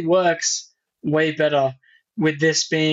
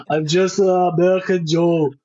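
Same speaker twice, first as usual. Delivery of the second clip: put-on slow, thick Austrian accent; deadpan Arnold in an Austrian accent; Schwarzenegger impression; slower than usual. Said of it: "where he's like".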